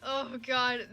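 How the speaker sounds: ordinary talk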